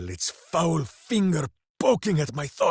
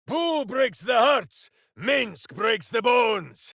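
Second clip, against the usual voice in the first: higher, slow, shouted boast; battle boast; 7 semitones higher, slower than usual. Acted. Minsc boasting of Boo and himself mid-fight, shouted. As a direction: proclaim it to the foe.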